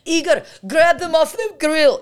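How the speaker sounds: high, loud imitation of mom hollering; shrieked-up mock kitchen yell. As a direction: yell orders to the cook as mom. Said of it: "there's a lot of yelling".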